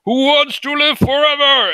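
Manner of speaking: high, loud heroic bellow; Hawkman battle cry. Acted. Game master voices the Hawkman swooping in, loud high theatrical cry. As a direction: cry it out grandly, far above speaking pitch. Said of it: "it's like".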